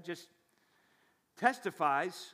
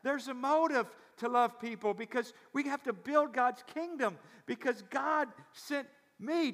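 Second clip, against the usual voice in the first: raised, emphatic sermon delivery; impassioned preaching; 9 semitones higher, 4 dB louder than usual. Real, not acted. Pastor preaching to his congregation about Jesus being sent, impassioned. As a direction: preach it out with a high voice.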